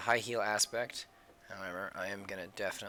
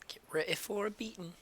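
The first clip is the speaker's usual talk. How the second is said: high, cocky taunt in a put-on fighter voice; a playful brawler's warning, pitched high; tough fighter girl threat; 11 semitones higher than usual. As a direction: voice the drawn girl daring you to fight. Voicing drawn girl about to kick.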